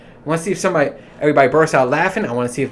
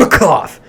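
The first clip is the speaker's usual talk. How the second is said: loud spoken-out "cough"; demonstrated cough; 2 semitones higher, 7 dB louder than usual. Shown as a demonstration. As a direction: bark out the word like a cough. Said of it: "that's how I cough".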